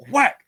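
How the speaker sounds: loud sharp whack sound effect; mock whack sound. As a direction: smack it out like a bat hit. Sound effect of bat hit.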